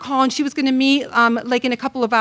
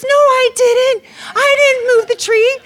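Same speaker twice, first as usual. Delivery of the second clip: whiny denial; 13 semitones higher, 8 dB louder than usual. Retold or imitated. Wife denies in acted quarrel.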